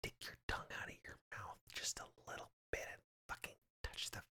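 fast hushed taunt; whisper. Pretend. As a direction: urge in a quick whisper. Whispered dare game.

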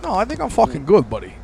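high, loud North Jersey refusal; New Jersey accent. Acted. Coma patient refusing his old voice, high New Jersey accent. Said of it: "we can get your regular voice back".

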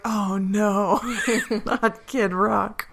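high slow mock-horrified wail; a touch of hammed-up dismay; mock horror. Voicing [name] appalled by a [name] memory, hammed up.

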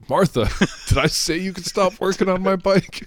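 high mock-angry husband, laughing; harsh barking commands. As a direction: bark it at his wife, half laughing. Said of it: "he's never like".

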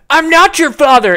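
high, loud imitated joke yell; mock yell. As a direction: yell it like an obnoxious teen. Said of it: "they just go".

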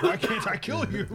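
high, put-on baffled voice; baffled Kratos. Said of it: "It's like".

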